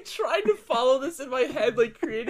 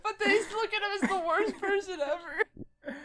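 high-pitched laughing words; barely getting words out through laughter; laughing while talking; 8 semitones higher, 3 dB quieter than usual. Real, not acted. Cracking up at story.

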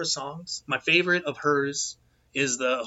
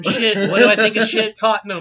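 mocking punchline; 6 semitones higher, 8 dB louder than usual. Real, not acted. Rival-club punchline joke.

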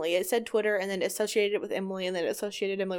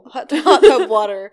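laughing; 13 dB louder, slower than usual. Real, not acted. Laughing retelling.

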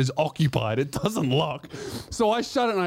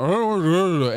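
mock slurred whine, a bit louder; whining refusal; 4 dB louder, faster than usual. Patient refusing the catheter, mock whiny slur.

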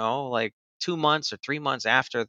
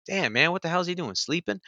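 high-pitched, softening incredulous question; retold exasperation; 7 semitones higher, 5 dB quieter than usual. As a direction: wonder aloud in mild frustration, pitch up. Retold frustration at unanswered calls.

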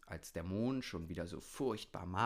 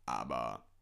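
low raven voice starting an objection; 10 semitones lower than usual. A narrator's reading. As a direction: begin deep, as the wise raven. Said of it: "begann der Rabe". (tiefe Rabenstimme)

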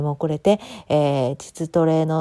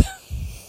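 high squeak of a laugh; 11 dB quieter than usual. Real, not acted. Laughing at own message.

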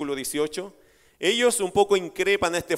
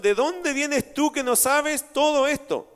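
astonished question; 7 semitones higher, slower than usual. Retold or imitated. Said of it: "es una expresión de admiración".

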